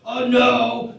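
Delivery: a cackling excited "oh no"; gleeful outburst. Acted. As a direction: blurt it out delighted. Crew member gleefully corrects ambassador.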